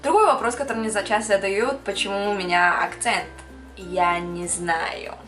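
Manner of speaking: ordinary talk, nothing marked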